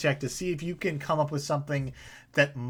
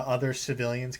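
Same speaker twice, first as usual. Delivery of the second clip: usual speaking voice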